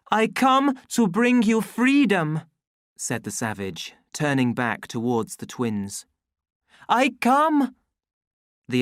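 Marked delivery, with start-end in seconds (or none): 0.1-2.4 high, loud proclamation. A narrator's reading. Savage proclaims to crowd. 6.9-7.8 high, loud proclamation. A narrator's reading. Savage proclaims.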